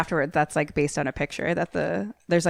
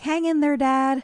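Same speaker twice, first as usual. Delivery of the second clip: put-on kid voice; 8 semitones higher than usual. Sons cheer their father.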